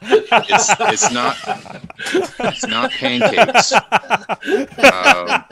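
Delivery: high-pitched, laughing through the words; cracking up. Real, not acted. Player answering after a crude wrong guess, cracking up. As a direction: barely get it out for laughing.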